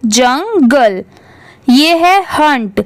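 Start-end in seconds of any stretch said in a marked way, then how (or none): 0.1-1.0 जंगल drawn out as जंग, गल; two measured syllables in जंगल; model for repeating. Shown as a demonstration. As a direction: say जंगल slowly for pupils to echo. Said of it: "मेरे बाद repeat करिए".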